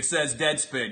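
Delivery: normal talking voice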